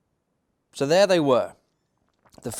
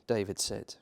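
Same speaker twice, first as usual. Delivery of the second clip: ordinary delivery, nothing marked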